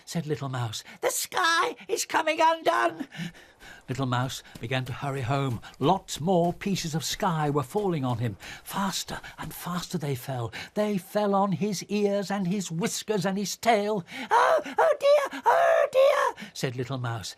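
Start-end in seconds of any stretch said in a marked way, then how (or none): 1.0-3.1 high, anxious little mouse; fretful wonder at the snow; worried. Acted. 14.3-16.5 very high, loud wailing oh dear; distressed. Acted. Mouse distressed by falling snow.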